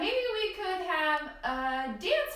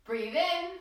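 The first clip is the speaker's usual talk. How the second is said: deep breath in. Shown as a demonstration. Guiding children through deep breaths, slow inhale. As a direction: breathe in deeply as you say it. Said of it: "breathe in through our nose".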